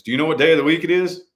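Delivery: paramedic questioning. Retold or imitated. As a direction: fire the question like a medic checking you. Paramedics questioning him, retold.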